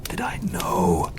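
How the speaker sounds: slow, breathy whisper of wonder. Acted. Creature waking to light, whispering in awe. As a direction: hush it as if barely awake. Creature wakes, awed and uncertain.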